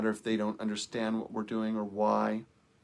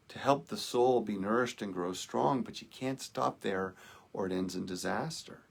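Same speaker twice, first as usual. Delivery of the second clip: ordinary talk, nothing marked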